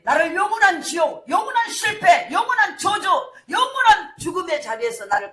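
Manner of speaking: loud, high, rising proclamation; preaching at full force. Real, not acted. A pastor crying out to the congregation, preaching full force. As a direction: hammer each phrase harder.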